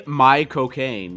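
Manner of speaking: put-on slow Cockney drawl in Michael Caine's accent; a joking Cockney Michael Caine pun, slow; Michael Caine impression. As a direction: stretch "my cocaine" out slowly like Michael Caine in Cockney. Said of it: "you're saying Michael Caine in his own accent".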